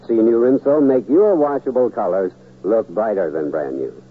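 ordinary delivery

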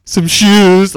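put-on high, loud little-kid blurt; excited blurting. Four-year-old spilling Mommy's gift secret to Dad, blurting. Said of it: "she just immediately, like, had to tell me".

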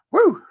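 loud, high "woo"; short hollered whoop. Pretend. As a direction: let out a piercing woo. Whoops after a quote.

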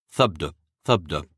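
deep muffled drum beat; low muted thump. A narrator's reading. Heartbeat sound of loom.

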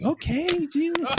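high, startled reported reaction; retold squeak of disbelief; taken aback. Pilot reacting to screaming controller, taken aback.

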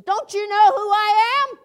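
loud, high, outraged demand; indignant shout. Retold or imitated. Governor shouting at the lunch lady, high indignant yell. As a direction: bellow it like a big shot insulted. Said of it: "he told her, he says".

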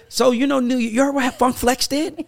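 high-pitched teasing New York imitation; mock New York accent. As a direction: imitate your friend's eager New York gossip. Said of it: "Where has the New York accent?".

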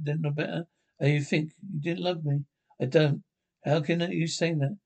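usual speaking voice

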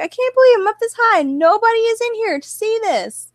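high, loud giddy inner voice; excited disbelief. Retold or imitated. Gambler retells her own thrill at the win, high and excited. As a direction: squeal with delight at the win. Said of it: "I'm like".

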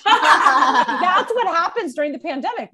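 said slowly through a laugh. Real, not acted. Host laughing off the divorce news, slow and laughing. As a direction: laugh while you explain.

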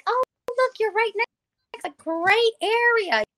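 mimicking an eager friend's pitch; persuasive girl.

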